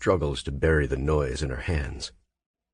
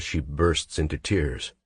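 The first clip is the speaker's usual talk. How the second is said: ordinary delivery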